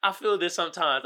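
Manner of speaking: normal talking voice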